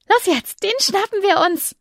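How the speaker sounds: hoch, eifriger Jungenton; aufgeregter Junge. Acted. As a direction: ein Kind treibt die Freundin an. Kinderfigur Verfolgung. (aufgeregter Jungenton)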